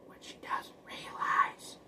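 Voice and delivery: breathy secret whisper, slowed down. Shown as a demonstration. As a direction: hush it like a secret reveal. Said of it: "repeats the line as a whisper".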